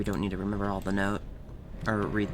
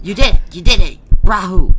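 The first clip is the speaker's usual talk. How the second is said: higher, much louder celebration; joyous yelled celebration; triumphant shout; 8 semitones higher, 21 dB louder than usual. Real, not acted. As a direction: shout out the victory. Celebrating success.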